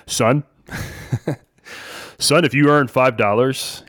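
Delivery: a light, slowed dad voice, only slightly shifted; hint of a dad giving his son advice; imitating my dad. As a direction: lightly play the fatherly advice-giver. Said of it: "I gotta do my dad".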